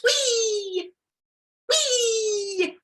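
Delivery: high, gleeful cry; gleeful whee. Acted. Flying fish soaring over the sea, high gleeful whee. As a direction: squeal with flying joy.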